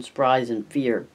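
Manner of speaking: plain reading voice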